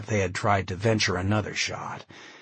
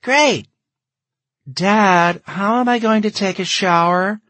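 high, loud protesting voice; exasperated daughter; 11 semitones higher, 8 dB louder than usual. Acted. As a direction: complain loudly to Dad, pitched way up. Daughter protests about cold shower.

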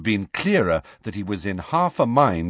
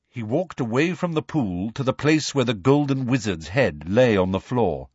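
plain reading voice

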